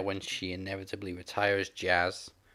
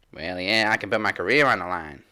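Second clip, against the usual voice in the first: loud, drawling imitation of Jazz's Southern accent; 8 dB louder than usual. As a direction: put on Jazz's Southern twang, loudly. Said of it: "that was my Jazz accent".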